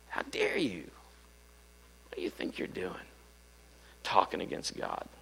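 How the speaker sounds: retold outrage, very soft under the breath; hushed indignation. Pastor confronting man speaking against God, hushed indignation. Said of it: "I was mad".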